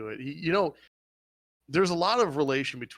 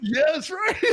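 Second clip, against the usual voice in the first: high, loud laughing yes; laughing excitedly; 7 dB louder than usual. Real, not acted. Agreeing with a friend about the fight, excited laugh. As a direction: shriek with delight.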